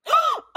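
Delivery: sharp high delighted gasp; a sudden loud gasp of delight; excited gasp. Real, not acted. Friend hears favourite actor named, real delighted gasp.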